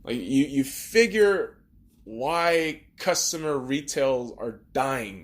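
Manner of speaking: usual speaking voice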